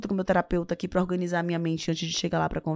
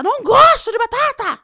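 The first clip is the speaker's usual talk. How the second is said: high, loud outburst; exasperated yell; 9 dB louder than usual. Acted. Guest acting someone offered potatoes once too often, exasperated yell. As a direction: yell it as if patience has finally snapped. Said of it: "você vai gritar".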